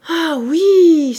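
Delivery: put-on old man suddenly remembering, loud and high; sudden exclamation. A narrator's reading. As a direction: cry out as the memory hits. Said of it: "s'écria-t-il".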